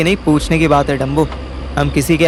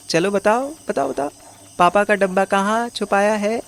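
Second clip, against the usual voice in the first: put-on higher sing-song coaxing, like to a small child; 4 semitones higher, 4 dB quieter than usual. Coaxing a dazed addict about his papa's box, in a higher sweet voice. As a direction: sweet-talk him into telling you.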